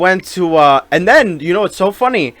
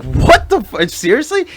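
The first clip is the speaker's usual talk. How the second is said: incredulous; 5 semitones higher than usual. Retold or imitated. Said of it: "I was like".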